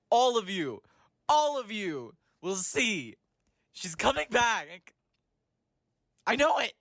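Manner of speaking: rising into a hammed-up prophecy; building mock proclamation. Fan declaring to all listeners she's coming back, building hammed-up. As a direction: build to a triumphant, slightly unhinged reveal.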